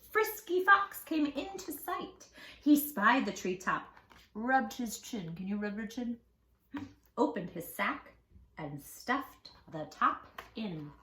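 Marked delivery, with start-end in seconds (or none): none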